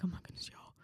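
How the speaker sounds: acted hushed whisper to friends; urgent whisper. Student secretly warning her friends about Paul, whispering. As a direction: whisper it in mock alarm, voiceless.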